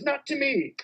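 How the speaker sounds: a quick, high, hushed refusal; lightly voiced officer keeping it secret. Customs officer refusing the cash, in a hushed high voice. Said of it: "he goes".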